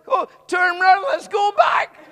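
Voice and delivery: rally him to go round again; excited call. Retold or imitated. Preacher urging the healed man back along the platform, thrilled and loud.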